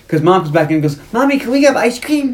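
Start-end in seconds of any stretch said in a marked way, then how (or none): 1.1-2.3 put-on high, sweet little-boy voice asking Mommy. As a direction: beg Mommy for ice cream like a small kid. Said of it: "goes from stone cold killer to little boy".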